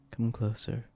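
very soft, beckoning murmur; feminine persona, hushed. Acted. Cohost miming the actor luring the doctor in, hushed beckoning. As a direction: coax someone nearer almost under the breath. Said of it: "feminine persona all of a sudden".

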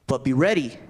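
ready pressed firmly, falling; be ready, weight on ready; stress on ready. Shown as a demonstration. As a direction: lean into ready. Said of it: "I emphasize that word ready".

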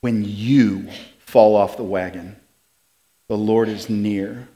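very low, soft reassurance; hushed and tender. Real, not acted. Preacher comforting listeners who have fallen themselves, hushed. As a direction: let it land gently and quietly. Refrain turned gently on listener.

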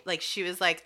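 usual speaking voice

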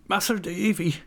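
high, anguished rustic cry; broken-voiced Ham. A narrator's reading. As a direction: cry out with a touch of heartbreak, pitched high. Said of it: "exclaimed Ham in a broken voice".

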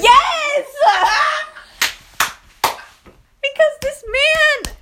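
high, loud, laughing shout; real gleeful shout; laughing yell. Real, not acted. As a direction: shout it back defiantly. Owns up to friend's accusation.

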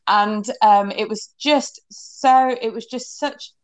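usual speaking voice

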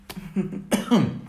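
sudden coughing fit from choking. Real, not acted. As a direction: cough hard as if something went down wrong.

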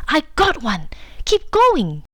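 a gleeful, pleased cheer; grinning hippo. A narrator's reading. Hippo catching a fish, cheering its friend on. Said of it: "made a sudden move and grinned".

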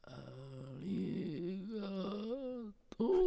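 quavering ghost thanks; slow, breathy ghostly voice. Acted. Ghost grandfather appears.

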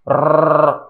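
"Rrr" as a long held rasp of the R; isolated R sound. Shown as a demonstration. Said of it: "Tem o R?".

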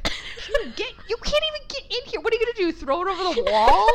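high, incredulous retort; mock exasperation. Exasperated retort to salon girl.

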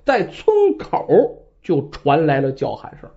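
usual speaking voice

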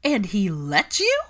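loud, slow, high disbelieving questions; astonished. Acted. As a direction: stretch each question out in shock. Pansy astonished by the news.

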